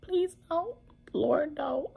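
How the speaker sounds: high, pleading cry to God; retold frightened prayer; desperate plea. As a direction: beg the Lord in a thin, high voice. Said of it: "I was like, "Lord".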